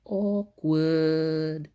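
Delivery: drawn-out, sing-song "awkward"; teasing child. Retold or imitated. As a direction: stretch the word in mock awkwardness. Mimics daughter's drawn-out one-word verdict.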